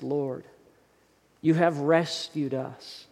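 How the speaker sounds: unhurried, earnest pastoral prayer; closing prayer. Acted. Pastor leading the church in a closing prayer. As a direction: pray over the congregation, steady and reverent.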